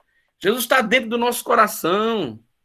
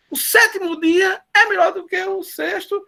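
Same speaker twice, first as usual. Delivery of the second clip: emocionado; 10 semitones higher than usual. Real, not acted. Emoção crescendo no jejum.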